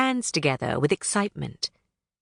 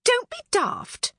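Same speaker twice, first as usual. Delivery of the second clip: much higher, slow scoffing pixie; dismissive pixie; slower than usual. Acted. Knotgrass scoffing at Thistlewit, high slow dismissive. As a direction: brush her off with scorn. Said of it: "Knotgrass replied".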